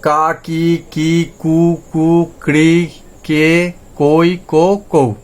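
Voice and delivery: each syllable drawn out slowly; slow, deliberate teacher drill. Shown as a demonstration. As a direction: sound out every syllable slowly for learners. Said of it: "তাহলে".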